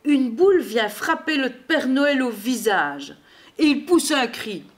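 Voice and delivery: ordinary delivery, nothing marked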